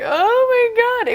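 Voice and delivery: very high, loud cry of dread; mock-panicked high exclamation; retold terror. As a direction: jump way up in pitch, horrified. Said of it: "It was, like, terrifying".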